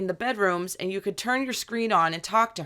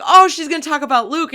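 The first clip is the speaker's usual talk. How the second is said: excited; 7 semitones higher, 9 dB louder, faster than usual. Retold or imitated. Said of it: "we were like".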